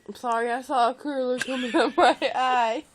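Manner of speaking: loud wail breaking into laughter; laughing apology. Real, not acted. Apologizing for seeing a curler, laughing loudly. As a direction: blurt it out through laughter.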